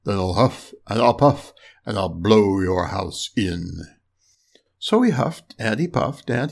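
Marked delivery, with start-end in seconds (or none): 0.1-3.8 big bad wolf, pitched down; threatening wolf. A narrator's reading. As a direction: threaten low, drawing out the last words. Wolf threatens to blow house in.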